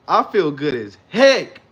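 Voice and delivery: big, slow, loud declaration; loud and excited. Shown as a demonstration. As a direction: belt it out with joy. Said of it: "Don't be like".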